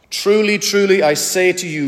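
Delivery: "Truly, truly" pitched high, each word stressed; high, emphatic "Truly, truly" as a solemn promise. Acted. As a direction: lean hard into "Truly, truly, I say to you". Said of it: "this emphatic way of saying".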